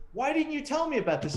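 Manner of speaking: high-pitched indignant demand, retold; ballistic boss. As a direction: imitate an outraged boss demanding answers. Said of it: "my lieutenant goes ballistic".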